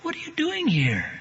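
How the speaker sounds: high, startled cry of disbelief on "here"; a mother seeing a ghost at the door; shocked mother. Retold or imitated. Mother opening the door to her presumed-dead son, gasping in shock.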